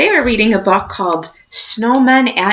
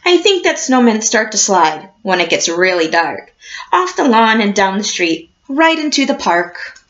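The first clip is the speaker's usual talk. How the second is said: put-on reading to children; 2 semitones lower than usual. A narrator's reading. Teacher reading a picture book to her class, storybook voice.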